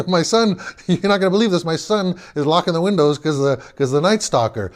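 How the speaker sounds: mock the son while chuckling; father laughing into the phone to his friend. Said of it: "he starts laughing".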